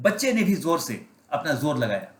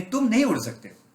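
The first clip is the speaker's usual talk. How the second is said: higher, mocking put-down; scornful hen; 8 semitones higher than usual. Hen telling the eagle chick he cannot fly, higher and mocking. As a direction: sneer it down at the little one.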